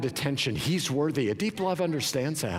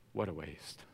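low, soft disappointed father; a hint of a let-down dad; imitating father; 11 semitones lower, 13 dB quieter than usual. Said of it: "he didn't say it exactly like that".

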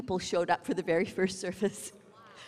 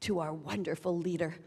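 lower, softer, smug mock arrogance; arrogant boast; 8 semitones lower, 8 dB quieter than usual. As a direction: play the proud commander, low and quiet. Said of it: "with a look of arrogance".

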